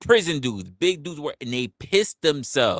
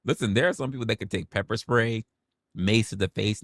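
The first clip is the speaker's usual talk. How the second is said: usual speaking voice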